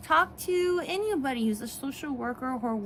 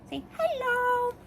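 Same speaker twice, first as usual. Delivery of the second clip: high, drawn-out coo to a pet; playful dog talk; 13 semitones higher than usual. Real, not acted. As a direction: coax the dog in a high, slow voice. Prompting the dog to greet viewers.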